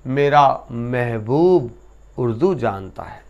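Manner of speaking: ordinary talk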